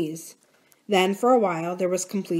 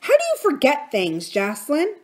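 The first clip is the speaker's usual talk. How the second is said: aunt voice; 7 semitones higher, 3 dB louder than usual. A narrator's reading. Exasperated aunt.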